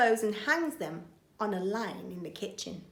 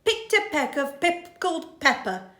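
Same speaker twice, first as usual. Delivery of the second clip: coaxing burglar; 9 semitones higher, 8 dB louder than usual. Acted. Burglar tests baby with tongue twister. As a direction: dare the baby with it. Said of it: "says Burglar Bill".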